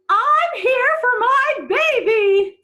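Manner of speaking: very loud, high, slow boom; big booming voice. A narrator's reading. Angela arriving at the pound, big slow boom. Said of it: "a big voice boom".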